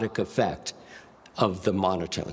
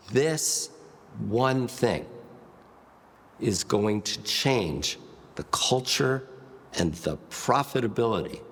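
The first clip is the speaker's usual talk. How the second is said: slowed pace. Shown as a demonstration. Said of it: "I've changed the pace".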